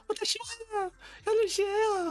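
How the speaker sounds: very high squealed anime-style parody; hammed-up, piercingly high fake Japanese; mock Japanese voice. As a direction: squeal mock Japanese far above your own voice. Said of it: "j'étais à deux doigts de parler japonais".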